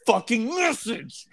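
forceful. Real, not acted. Co-host ordering his friend to pass it on, forceful. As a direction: push it out hard as an order. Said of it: "he just said that so forcefully".

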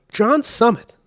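[name] called out high and loud; proclaiming loudly. Real, not acted. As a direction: announce [name] like a winner. Repeated, proclaimed.

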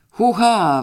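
loud, high dance whoop; ecstatic yell. Acted. Father dancing wildly in the hall, loud whoop. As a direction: holler it as you leap into a dance. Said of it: "wrzeszczał".

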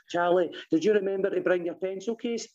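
put-on schoolteacher voice; imagined teacher. Imagined teacher asking Charlie.

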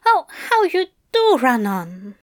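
bashful Aziraphale. Acted. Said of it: "his cheeks red and his eyes averted".

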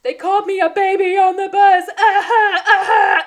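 high, loud mock bawling; cartoon crying. Teacher imitating a crying boy at recess, cartoon bawling. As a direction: wail it like a sobbing cartoon kid. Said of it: "crying like a cartoon character".

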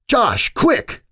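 high, urgent yell; mild yell. Acted. Pointing at the water, yelling to Josh urgently. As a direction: yell at him to hurry. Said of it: "I yelled".

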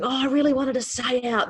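high, whiny cry of protest; hysterical teen wail. Retold or imitated. Daughter wailing to mum about leaving early, high and hysterical. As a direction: wail it like an upset teenager who was dragged home. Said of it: "Georgia was completely hysterical".

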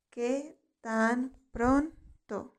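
"¿Qué tan pronto?" very slow, each word set apart; slow word-by-word repetition. Shown as a demonstration. Teacher models "¿Qué tan pronto?" for learners to repeat, slow and segmented. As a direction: stretch and separate the words of "¿Qué tan pronto?".

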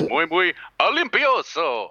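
slow, emphatic mock-Spanish through a laugh; laughing catchphrase. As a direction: deliver it with authority, chuckling. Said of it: "you have to say it with authority".

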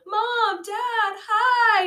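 high-pitched, hammed-up delighted call; happy greeting. Priest greeting his parents across the street, hammed-up happy call. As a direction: call out like an overjoyed son, mock-cheery. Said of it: "happy parents. And he's like".

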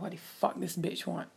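hushed, breathy under-the-breath curse; muttered aside. Retold or imitated. Hearing roommate knock on door, a hushed annoyed aside. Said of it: "I was like".

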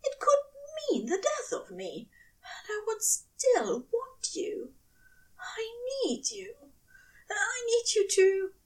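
high, whispered, breathless longing; angel yearning. A narrator's reading. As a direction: plead in a hushed need.

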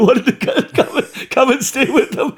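dissolving into high, loud laughter; laughing. Real, not acted. Comedian relaying therapist's chalet invitation, laughing helplessly. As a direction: try to finish while cracking up. Cracks up delivering his own line.

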